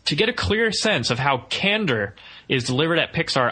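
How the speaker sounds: normal talking voice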